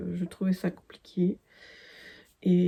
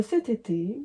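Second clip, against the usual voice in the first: usual speaking voice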